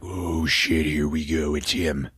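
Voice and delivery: low, soft mock panic; uneasy aside, acted out quietly; hushed dread. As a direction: mutter it low under your breath. Characters dread his arrival, retold.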